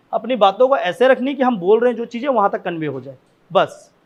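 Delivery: ordinary talk, nothing marked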